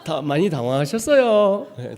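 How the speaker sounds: loud mock Joseonjok accent; Korean-Chinese accent. Preacher parodying a caller telling customers not to panic, Joseonjok accent. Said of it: "자꾸 조선족이래요".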